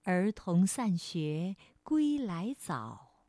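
slow, gentle classical verse; soft poem recitation. Shown as a demonstration. Teacher reads the old poem to children, slow soft recitation. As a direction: recite softly, lingering on each line ending. Repeats the poem more softly.

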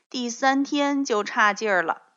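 usual speaking voice

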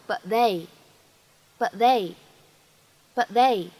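"but they" with the t held and not released; repeated "but they" with unreleased t; incomplete plosion. Shown as a demonstration. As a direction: swallow the t of "but" before "they". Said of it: "我们可以读成but they".

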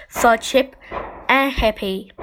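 plain reading voice

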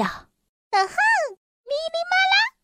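very high elf voice with a magic word; elf casting spell. A narrator's reading. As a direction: chant a tiny, high-pitched spell. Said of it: "只有精灵才懂的咒语".

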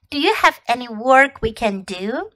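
ordinary delivery

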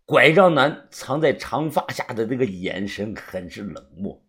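ordinary delivery

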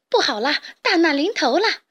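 loud, high cry of alarm; panicked shout. A narrator's reading. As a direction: warn everyone disaster is coming. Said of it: "卡利妈惊慌地大喊".

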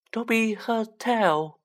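high, slow elf voice; small creature speaking slowly at high pitch; hoarse house-elf. Acted. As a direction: draw it out high, as a grave confidence. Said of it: "he said hoarsely".

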